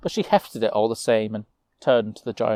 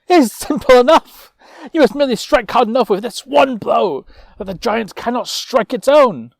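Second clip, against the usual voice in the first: much higher, louder knight voice; eager knight; 13 semitones higher, 10 dB louder than usual. Acted. Knight urging the nobles, high and loud. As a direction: pipe up brightly with a simple plan.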